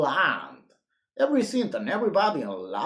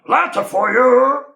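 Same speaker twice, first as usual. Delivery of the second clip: loud, higher shout; shouting postman; 8 semitones higher, 11 dB louder than usual. Acted. Postman announcing the letter, shouting. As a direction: boom it through the door.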